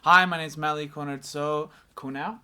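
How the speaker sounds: loud mock-formal introduction; imitating his intro. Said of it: "You see how you just said".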